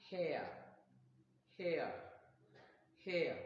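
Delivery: HAIR with a gliding air vowel, falling; diphthong pronunciation. Shown as a demonstration. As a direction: model HAIR carefully, each one standing alone. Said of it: "pronounced as".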